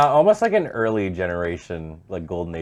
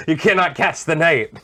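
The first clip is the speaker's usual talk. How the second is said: taunting; 9 semitones higher, 7 dB louder than usual. Pretend. Taunting opponent during game.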